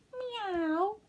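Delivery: high meowing cat; high sing-song cat meow. Acted. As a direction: mew like a cat. Cat noise in story.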